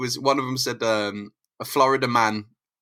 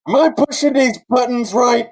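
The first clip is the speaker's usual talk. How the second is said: ordinary talk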